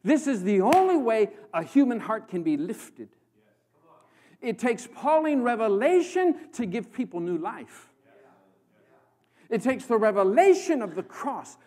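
loud, driving sermon voice; full-force preaching. Real, not acted. Preacher pressing his point on the congregation, loud and driving. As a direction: press the revelation home to the crowd.

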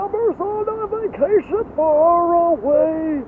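high squeaky elf voice, sung; chirpy Santa's elf singing; Christmas elf. Pretend. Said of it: "I sound like Elf, I think, a little bit".

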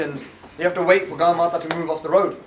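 ordinary delivery

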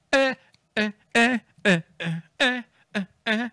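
high, rhythmic "eh" chops; imitated vocal hook. Fan carrying on the song's hook, high chanted ehs. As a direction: punch out short high ehs to the beat.

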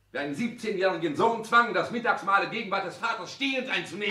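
loud, high, heated charge; angry accusation. Acted. Son hurling his grievance at his father, loud. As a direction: let the old grievance explode.

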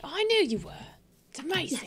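bad British accent. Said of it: "My bad British accent".

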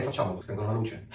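ordinary delivery

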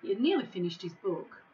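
ordinary delivery, nothing marked

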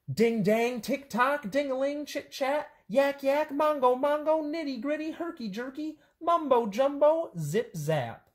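high, chanted string of nonsense pairs; playful high-pitched tongue-twister list; back-to-back read-out. Shown as a demonstration. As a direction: reel off each silly pair high, dropping on the last. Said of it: "It's pretty fun to read 'em back to back".

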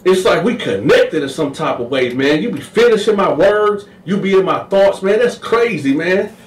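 high, excited inmate voice; lively high put-on voice; high voiced Sweet Lo. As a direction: gush like a smitten buddy. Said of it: "Sweet Lo was like".

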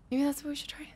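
soft, breathy unvoiced whisper; whispered aside. Real, not acted. Whispering the next code guess to the stream. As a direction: whisper it like a secret plan to yourself.

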